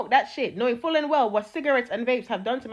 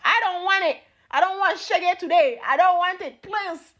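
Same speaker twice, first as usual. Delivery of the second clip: higher, pleading whimper of mock fear; 7 semitones higher than usual. Begging God to spare her, in mock fear. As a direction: beg off like a scared kid. Pleading to avoid God's wrath.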